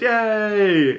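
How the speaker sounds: high, drawn-out mock cheer; imitated crowd cheer. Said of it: "And everyone goes".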